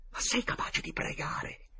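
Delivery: slow, high frightened whisper; slow scared whisper. Acted. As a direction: hush the question in terror. Frightened boy asks in hiding.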